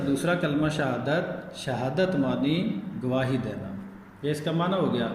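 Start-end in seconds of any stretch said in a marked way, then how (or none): 0.1-3.7 slow recitation. Shown as a demonstration. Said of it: "کیا نام لینا ہے جی اس کا".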